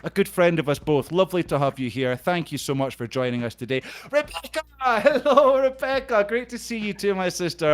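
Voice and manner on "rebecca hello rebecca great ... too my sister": high, gleeful hail by name; delighted high-pitched welcome; excited greeting. Real, not acted. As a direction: call out a thrilled hello, much higher.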